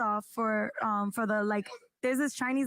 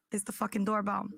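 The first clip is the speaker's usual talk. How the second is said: normal talking voice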